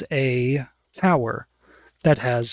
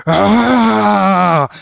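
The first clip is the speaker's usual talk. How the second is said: guard's sharp cry of pain, high and loud; pained yelp; 6 semitones higher, 8 dB louder than usual. Acted. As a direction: yelp as the finger is bitten. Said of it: "He goes".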